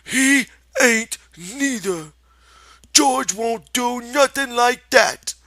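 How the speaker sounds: high, loud, slow defiant protest; belligerent retort. Acted. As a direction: push back hard like a stubborn child. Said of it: "retorted belligerently".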